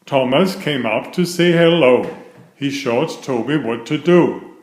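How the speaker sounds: ordinary delivery, nothing marked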